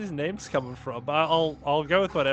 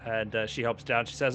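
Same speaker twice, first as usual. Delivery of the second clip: ordinary talk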